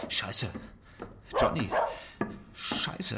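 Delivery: hissed under the breath; frightened whisper; whispered curse. Acted. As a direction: curse softly so Johnny won't hear.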